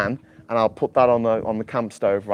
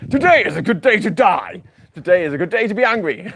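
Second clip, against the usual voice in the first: loud, higher Klingon battle cry; Klingon warrior; 6 semitones higher, 3 dB louder than usual. Acted. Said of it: "Klingon descent".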